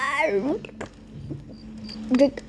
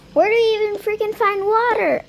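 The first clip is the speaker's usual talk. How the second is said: exasperated; 8 semitones higher, 5 dB louder than usual. Acted. Exasperated search for water.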